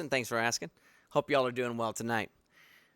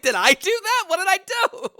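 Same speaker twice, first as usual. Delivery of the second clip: mock confusion; 7 dB louder than usual. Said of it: "I was like".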